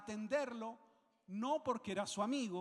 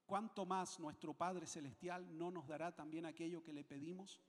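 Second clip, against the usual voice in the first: ordinary talk, nothing marked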